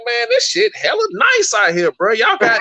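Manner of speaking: very high, slow impressed voice; hammed-up wide-eyed visitor; mock amazed tourist. Retelling his first California visit, high amazed mock voice. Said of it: "I was like".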